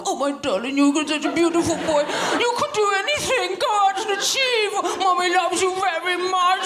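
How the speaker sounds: high, gushing mummy voice; doting mother. Acted. Mother fawning over her beloved son, hammed-up doting. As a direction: coo adoringly, climbing very high. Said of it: "with mothers and sons, it's a bit more like".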